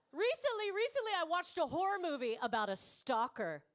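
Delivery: ordinary delivery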